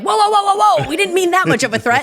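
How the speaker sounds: high, loud, hammed-up whoas; mock alarmed backpedaling. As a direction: back off in mock alarm, pitch jumping up. Mock panicked backpedaling of those accused.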